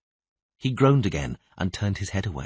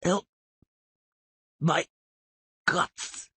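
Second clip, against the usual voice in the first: dying weak voice; 5 semitones higher, 4 dB quieter, slower than usual. Acted. Dying man speaks weakly.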